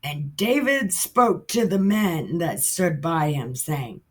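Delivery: normal talking voice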